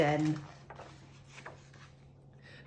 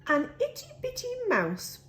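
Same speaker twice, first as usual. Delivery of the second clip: squeaky mouse voice; 4 dB quieter, faster than usual. A narrator's reading. Tiny mouse introduced.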